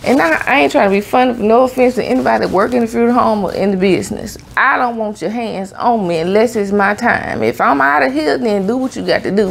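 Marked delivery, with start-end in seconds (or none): none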